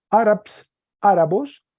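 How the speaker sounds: Árabs, árabos pronounced high and loud; teacher sounding out árabos carefully; Greek declension read out. Shown as a demonstration. Teacher demonstrates Greek nouns to class, emphatic. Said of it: "Entonces se lee".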